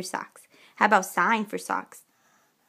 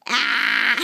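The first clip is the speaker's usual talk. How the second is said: ordinary talk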